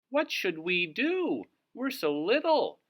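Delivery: a tiny animal feeling helpless; high timid voice; Harry Clary's voice. Acted. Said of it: "said Harry Clary".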